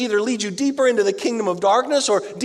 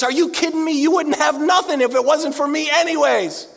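mock indignant; 7 semitones higher than usual. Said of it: "He looks at his men and he says".

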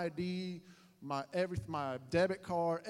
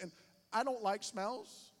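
ordinary delivery

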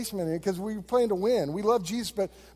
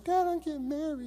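put-on higher, light little girl voice; 8 semitones higher, 4 dB quieter than usual. Pastor voicing his daughter as a little girl, higher. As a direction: say it like a small daughter. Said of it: "this little girl going".

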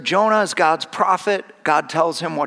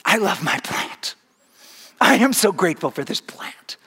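happy whisper. Acted. Jonah delighted with his plant.

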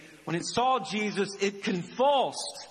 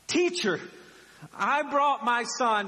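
higher, projected cry to the teacher; calling out urgently; 5 semitones higher than usual. Acted. Father pushing through a crowd to Jesus, calling out urgently. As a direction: call over a crowd, drawing out the plea.

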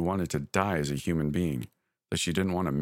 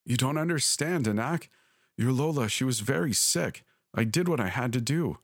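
high, anguished pleading; mother confesses to her child, voice pitched high; distressed mother; 6 semitones higher than usual. A narrator's reading. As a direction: beg your child to understand. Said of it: "My mother was screaming".

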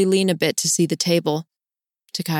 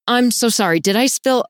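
higher, louder startled apology; flustered apology; 6 semitones higher, 3 dB louder than usual. Acted. Flustered apology after near collision.

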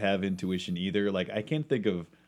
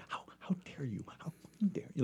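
angry whisper; 7 semitones higher, 10 dB quieter, faster than usual. Retold or imitated. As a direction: whisper it in high-strung outrage. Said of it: "they were whispering it to me".

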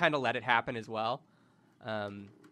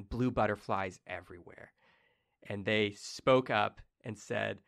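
ordinary talk, nothing marked

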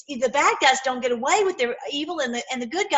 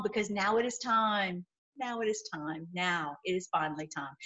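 dropping quieter, lower each repeat; fading solemn; 4 semitones lower, 8 dB quieter than usual. Real, not acted. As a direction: let it sink in softly. Solemn buildup to finale.

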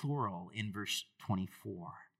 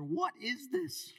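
slow, much higher astonished question; amazed crowd; 14 semitones higher, much slower than usual. Acted. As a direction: gasp it out in disbelief. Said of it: "They were all amazed".

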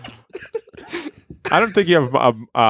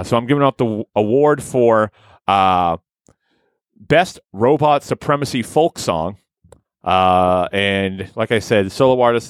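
ordinary delivery

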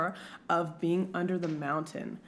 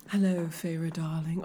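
lower, understated British accent; lighter British accent; 4 semitones lower than usual. Shown as a demonstration. Co-host testing how heavy the accent is, light British. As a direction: try it in a restrained British accent. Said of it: "How heavy is the accent?".

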